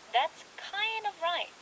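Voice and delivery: very slow teaching read; slow model phrase. Shown as a demonstration. Host modeling the phrase slowly for listeners. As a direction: say it slowly for learners. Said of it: "대충 맞아".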